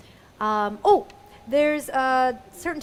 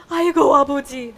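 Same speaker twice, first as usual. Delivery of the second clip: loud, quick dramatic "아이고"; exclaimed lament; 3 semitones higher, 12 dB louder, much faster than usual. Shown as a demonstration. Showing how they say oh my God father, dramatic exclamation. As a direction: cry out "아이고" loudly, falling on "아버지". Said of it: "This is how they say it".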